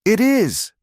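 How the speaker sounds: high, sharp yell. A narrator's reading. Alina yells at Eli, shoving him away. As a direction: fling it back at him. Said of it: "she yelled".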